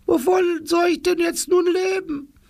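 put-on very high, frail old woman rising to a worried cry; worried old granny. Oma Rumpelputz fearing for her pension, high and anxious. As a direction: let an old lady's worry spill out. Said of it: "lächelte mit traurigen Augen".

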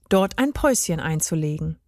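usual speaking voice

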